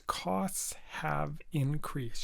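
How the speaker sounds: "Costs have increased" slowly, a short gap after each word; three separate words. Shown as a demonstration. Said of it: "should be three words".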